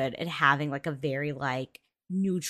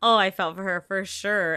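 ordinary talk

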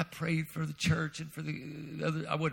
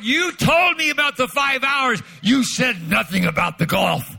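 loud, higher indignant protest; retold indignant complaint; 9 semitones higher, 11 dB louder than usual. Preacher venting at Doctor Cho about the golf, loud indignation. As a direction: call out the unfairness. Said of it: "I was so angry".

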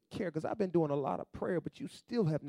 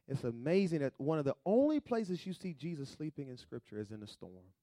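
ordinary delivery

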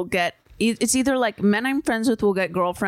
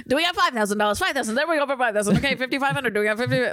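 higher-pitched auctioneer patter; 3 semitones higher, much faster than usual. Acted. Answering what they're called, auctioneer bidding chant. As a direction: rattle off bids like an auctioneer.

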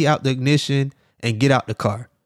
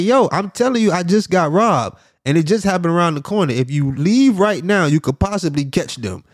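raised pitch, pleading with police; urgent, higher-voiced retold plea; exasperated insistence; 5 semitones higher than usual. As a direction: press the point in re-enacted frustration.

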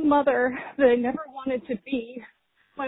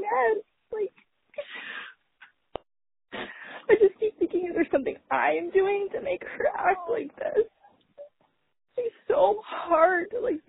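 crying; 8 semitones higher than usual. Real, not acted. Exhausted mom venting to the show, voice breaking into tears.

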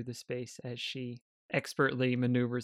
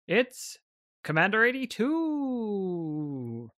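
booming arena announcer; 5 semitones higher, 4 dB louder than usual. Acted. Game master announces fighters to the arena crowd, booming announcer voice. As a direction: announce it big, stretching the words out. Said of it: "booming voice of an announcer".

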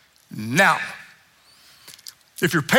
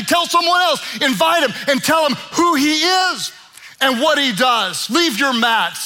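higher and louder, driving each short command home; fiery preaching; 6 semitones higher, 3 dB louder than usual. Acted. Pastor urging the congregation to let go, rising to a shout. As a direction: preach it hard, building to a shout.

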